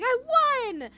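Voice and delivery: I won!, thrown up loud and high; excited kid cheer. Acted. Young boy cheers winning checkers, excited. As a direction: crow the win like a small kid.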